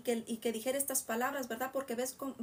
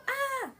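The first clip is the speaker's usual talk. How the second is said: short, high-pitched acted scream; grito del endemoniado; 13 semitones higher than usual. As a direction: cry out as the unclean spirit. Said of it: "el cual dio voces diciendo". (grito agudo de endemoniado, actuado)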